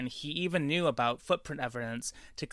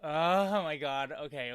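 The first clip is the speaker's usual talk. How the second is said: ordinary delivery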